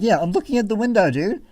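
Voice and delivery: mock exasperated reply. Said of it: "And I'm like".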